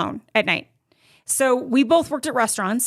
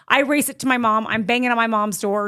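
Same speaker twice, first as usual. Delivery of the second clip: normal talking voice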